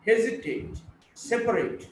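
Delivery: HES-itate, SEP-arate, openings punched; first-syllable stress. Shown as a demonstration. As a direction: stress "hesitate" and "separate" on their first syllables. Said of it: "first syllable".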